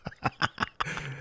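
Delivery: low-key rapid chuckle; quiet chuckle. Real, not acted. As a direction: chuckle softly in the background. Reacting to co-host's recap.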